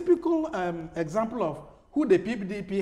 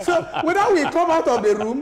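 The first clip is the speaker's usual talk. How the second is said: louder and higher through a laugh; 7 semitones higher, 5 dB louder than usual. Real, not acted. Guest extending the room joke to host, laughing. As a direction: carry on over the laughter, raised voice.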